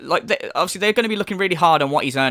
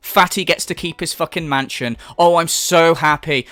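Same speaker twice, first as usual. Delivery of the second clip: mock relief; 2 semitones lower, 4 dB louder than usual. Commentator mocking a man who keeps his mansion, sarcastic relief. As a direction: lay on the fake relief thick.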